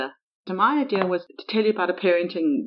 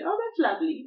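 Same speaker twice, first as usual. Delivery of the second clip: mock admiring; 10 semitones higher than usual. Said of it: "and went".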